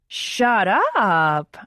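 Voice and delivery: loud playful yell at him. A narrator's reading. Narrator yelling at Oliver, shut up, in a loud playful yell. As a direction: shout it out, teasing. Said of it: "I yell".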